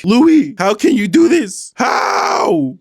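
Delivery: very high, loud, slow accusing wail; mock hysterical shout. Acting Heather screaming at Louis, very high and loud. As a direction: scream it like she's losing her mind. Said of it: "Heather comes back saying".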